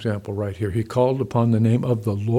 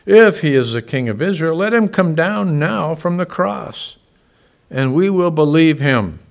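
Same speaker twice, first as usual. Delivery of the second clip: loud, scornful dare; mocking taunt; 6 semitones higher, 8 dB louder than usual. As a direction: pastor voicing the priests taunting Jesus on the cross, loud and scornful.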